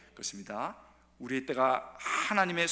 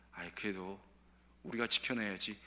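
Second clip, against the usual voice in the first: soft, lower steady reply; calm resolve; 7 semitones lower, 4 dB quieter than usual. Pretend. Faithful worker calmly resolving to keep going, soft. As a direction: answer gently but firmly.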